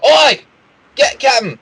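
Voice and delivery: loud high call across the deck; bandit shouting. Acted. Bandit calling the captain over, loud shout. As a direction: yell for the captain.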